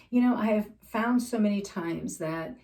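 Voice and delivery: ordinary talk, nothing marked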